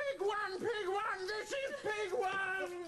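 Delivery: high, hammered-out shout; angry shouting. Acted. Puppeteer showing helper the right pig, shouting. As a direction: shout it, stretching the last words. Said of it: "Don't you shout at her".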